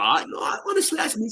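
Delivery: high, eager insisting. Retold or imitated. Convincing the barmaid he's in the band, high and eager. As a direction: pitch it up and insist it's really you. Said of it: "And I'm like".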